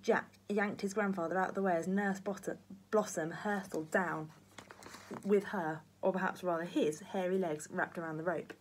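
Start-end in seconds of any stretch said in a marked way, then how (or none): none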